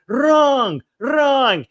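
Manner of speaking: high-pitched, falling cry; mock scolding. Mimicking critics of the accent, high mock scolding. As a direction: imitate a critic crying out a correction.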